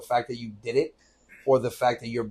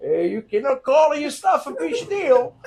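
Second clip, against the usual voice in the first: high, exaggerated protest from a father; mock-indignant dad, pitched way up; imitated father; 13 semitones higher, slower than usual. Son imitating his father scolding him, high mock indignation. Father retold in raised acted voice.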